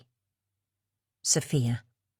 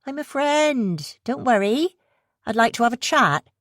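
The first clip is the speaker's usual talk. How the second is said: disarming West Country woman; 8 semitones higher than usual. Acted. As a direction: win her over in a West Country lilt. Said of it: "The woman laughed disarmingly".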